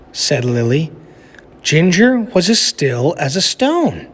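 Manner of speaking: lively storybook narration for a class; reading aloud to children. A narrator's reading. Teacher reading a picture book to her class, animated storytelling. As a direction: read it like story time for kids.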